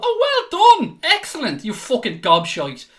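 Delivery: high sarcastic mock cheer; sarcastic fake congratulation; mock praise. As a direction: sneer fake praise then swear. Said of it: "sit on here and go".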